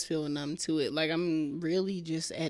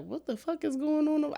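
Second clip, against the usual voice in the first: much higher, startled outcry; shocked neighbor; 10 semitones higher than usual. Retold or imitated. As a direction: play the neighbor jolted by the screaming. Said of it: "I know the neighbors was just like".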